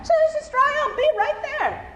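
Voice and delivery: high, chirpy reassurance. Acted. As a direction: toss it off lightly, high up.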